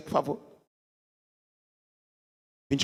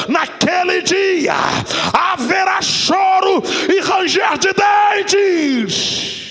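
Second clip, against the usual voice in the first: preaching at full force; 6 semitones higher than usual. Acted. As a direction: hammer each plea at the congregation. Preaches on false prophets.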